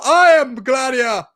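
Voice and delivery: movie quote. Retold or imitated. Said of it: "who's the guy who stands up".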